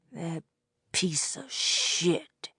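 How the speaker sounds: quiet, slow, seething curse; hard, controlled, throaty. A narrator's reading. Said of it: "In a hard, controlled, throaty voice".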